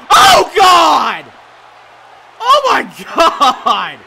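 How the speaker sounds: loud, high, drawn-out call; excited shout. Real, not acted. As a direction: scream it at the big move. Big move in the match.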